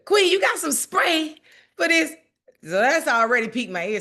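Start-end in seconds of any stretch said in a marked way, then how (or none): 0.0-2.1 high, loud, breathless sister rushing in; mock excited friend. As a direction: play the flustered friend begging for bug spray.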